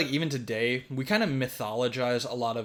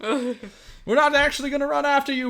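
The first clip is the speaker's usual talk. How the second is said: much higher, louder nagging grown-ups; fussy adults; 12 semitones higher, 4 dB louder than usual. Retold or imitated. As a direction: scold half-heartedly from afar. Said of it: "all of the adults are like".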